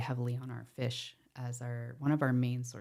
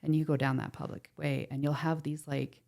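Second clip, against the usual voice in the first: ordinary delivery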